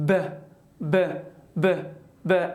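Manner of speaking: ب repeated with the voice on; voiced b demo. Shown as a demonstration. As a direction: say ب over and over, throat vibrating. (ب واکدار و تکراری)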